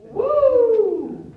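long, high, loud "woo"; high sustained whoop; overwhelmed whoop. Acted. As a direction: let out a big drawn-out woo. Exclaims at the question barrage.

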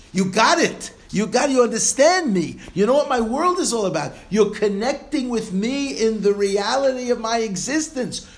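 God exclaiming to his people in jubilant shouts; God overjoyed. Acted. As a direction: cry it out as a delighted, proud father. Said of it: "HaKadosh Baruch Hu says".

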